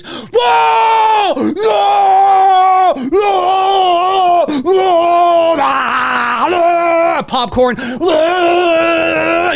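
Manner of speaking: high, loud mocking shouts; obnoxious yelling. Mocking rowdy moviegoers filming for TikTok, hammed-up shouting. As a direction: ham up a rowdy theater crowd. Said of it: "And then there's".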